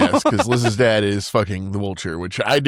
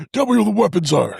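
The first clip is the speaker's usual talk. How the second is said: mock interrogation voice; 11 semitones higher, 4 dB louder than usual. Mocking Spider-Man's put-on voice.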